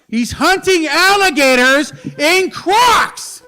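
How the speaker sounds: high, loud, drawn-out shout; stretched-out comic bellow; incredulous yell. Real, not acted. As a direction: yell the punchline slowly in disbelief. Incredulous yelled comic punchline.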